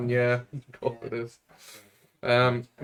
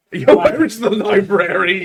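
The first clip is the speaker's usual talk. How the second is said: mock tourist voice; 5 semitones higher, 6 dB louder than usual. Player mimics phrasebook French.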